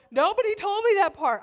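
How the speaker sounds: high, loud comic outburst; exasperated protest. Acted. Babysitter complaining about having to be active, exasperated. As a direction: protest it loudly, way up in pitch.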